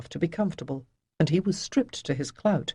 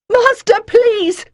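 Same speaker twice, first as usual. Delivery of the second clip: much higher, loud pleading cry; shriek; 11 dB louder, slower than usual. Acted. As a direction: scream the plea. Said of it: "Demisa shrieked".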